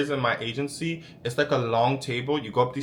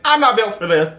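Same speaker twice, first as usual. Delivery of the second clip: higher, louder repeat of I'm not built for this; exasperated outburst; 7 semitones higher, 9 dB louder than usual. Retold or imitated. To himself, quitting the job, exasperated outburst. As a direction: blurt it out fed up.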